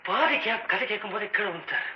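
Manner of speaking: creeping campfire horror voice; hushed suspense tale. Acted. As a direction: pull him into the dark, step by step. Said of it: "ஒரு suspense கதை, மர்மம்".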